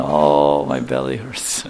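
low, slow groaning complaint; belly ache groan. Retold or imitated. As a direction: moan like an overstuffed glutton. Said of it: "start saying".

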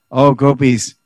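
"Oh gopis" called out loud and high; impassioned plea. Acted. Lalita calling to the rival gopis, a loud impassioned plea. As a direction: cry out to the gopis.

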